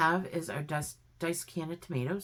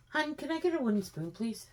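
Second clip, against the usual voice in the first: high-pitched, quick call to her partner; 9 semitones higher than usual. Real, not acted. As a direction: sing out the request across the room. Calls to her helper for spoon.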